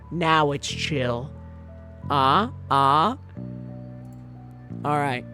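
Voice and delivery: ordinary delivery, nothing marked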